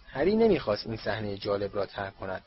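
usual speaking voice

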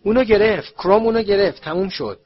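high, very loud triumphant yell; full-voiced excited shout, easing at the end. A narrator's reading. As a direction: cry out that it's over. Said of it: "هری فریاد زد".

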